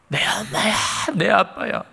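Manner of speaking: put-on high, childish wailing insistence; child's protest. Little child protesting to teasing adults, high wail. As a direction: play the little kid yelling back at the grown-ups. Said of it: "막 악을 쓰면서".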